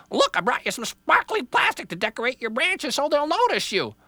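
very high, loud squawky bird; an alarmed jaybird blurting a warning; frantic bird voice. Acted. Jaybird warns Little Tree of the axe, high squawk.

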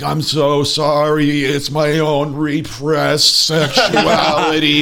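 high, slow, dazed apology; drawn out like a stunned man; slow confessional whine. Acted. The zapped driver apologizes to Jerry, high and slow. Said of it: "And he's like".

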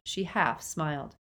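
usual speaking voice

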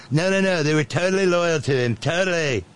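high, arguing caricature; Nitwit Nero. Acted. Boss contradicting his chief of staff, high mock arguing. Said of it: "He even argues with it".

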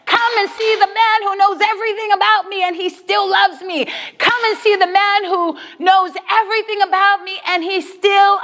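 high, fervent acted testimony; excited proclamation. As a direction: call the whole village to come and see. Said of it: "she tells everyone".

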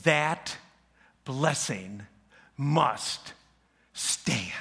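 each word set apart with pauses; old father declaring his decision, deliberate; slow and firm. Retold or imitated. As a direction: plant every word like a verdict. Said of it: "he answered this way".

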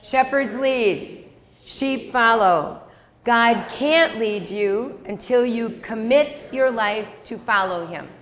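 slow, measured unison reading; slow read-along. Shown as a demonstration. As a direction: spell it out slowly so the room can read along. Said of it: "Read that with me".

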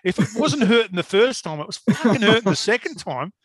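high-pitched, worked-up protest; retold exasperation. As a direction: act out telling the clumsy helper off. Retold cursing after forklift drops again.

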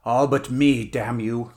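loud opening, cursing retort; fierce cry. A narrator's reading. Stranger answering his hated enemy, fierce cry. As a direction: throw it back at him fiercely. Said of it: "cried the other".

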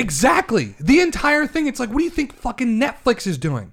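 high, worked-up exasperation; heated rant. Real, not acted. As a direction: burst out in agreement, high-pitched and worked up. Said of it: "your veins are gonna pop out".

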